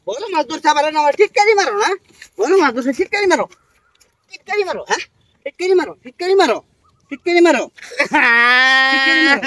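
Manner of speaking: angry scolding. Real, not acted. Fisherman yelling at the boy who teased his catch, furious. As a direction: yell at the one who mocked you.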